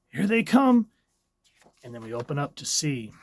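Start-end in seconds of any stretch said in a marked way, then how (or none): 0.1-0.9 higher, louder alarmed cry; urgent shout. Acted. Reader voicing the panel, now shouting in alarm. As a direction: yell the warning at its peak.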